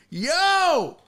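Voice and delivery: imitated high, drawn-out heckler's holler; calling out. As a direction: call out to the stage like an audience member. Amputee in crowd calls out.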